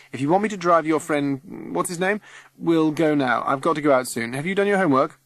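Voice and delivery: a rushed father firing off orders; dad in a hurry, high and loud; busy dad. Acted. Dad voiced in story.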